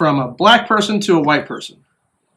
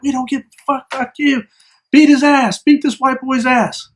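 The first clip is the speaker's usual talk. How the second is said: high-pitched mock hostility; pitched-up aggression without real shouting; imitated angry threats; 7 semitones higher than usual. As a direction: imitate an angry group goading each other. Imitates angry kids threatening him.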